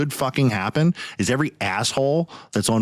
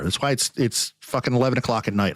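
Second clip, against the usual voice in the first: ordinary talk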